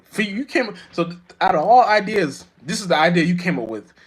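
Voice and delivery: high, disbelieving quote; incredulous. Retold or imitated. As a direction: ask like you can't believe anyone thought of it. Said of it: "I'll be like".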